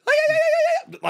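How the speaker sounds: high, loud imitated war cry; rapid-fire shrieked battle yell, imitating Xena; Xena scream. Fan mimics her on hearing that scream, imitated Xena war cry. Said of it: "She would just start screaming like that".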